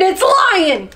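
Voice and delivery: high mock-alarm shout; shouted warning. A narrator's reading. Monkey and meerkat scaring chameleon, shouting. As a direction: call out the alarm, pitch up. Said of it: "they shouted".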